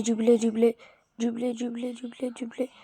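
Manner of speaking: ordinary talk, nothing marked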